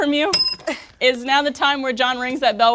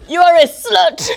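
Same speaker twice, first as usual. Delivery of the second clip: higher, louder laughing taunt, a bit slow; mock insult; 6 semitones higher, 8 dB louder, slower than usual. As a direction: spit it out with theatrical glee. Acted duel insult.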